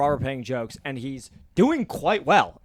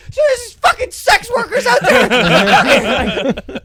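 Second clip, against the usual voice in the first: very high, drawn-out full yell; shouting comic; 11 dB louder, much slower than usual. Retold or imitated. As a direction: bellow the bit at the room in a high voice. Comic resumes his shouted bit.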